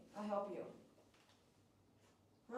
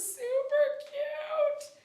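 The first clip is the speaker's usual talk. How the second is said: gushing; 5 dB louder, much slower than usual. Real, not acted. Gushing over leg fur.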